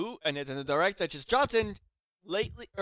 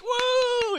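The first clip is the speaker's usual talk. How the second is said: loud, high crowd-style whoop; big mock cheer, drawn out; cheering "woo"; 9 dB louder than usual. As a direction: imitate a crowd cheering wildly. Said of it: "I was like cheering".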